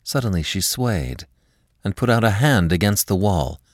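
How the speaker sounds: ordinary delivery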